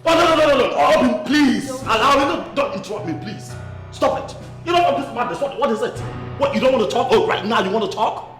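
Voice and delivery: high, loud, exasperated shouting; angry outburst. Acted. Husband cuts off the interrupter in a quarrel, angry outburst. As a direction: shout over them, voice pitched high.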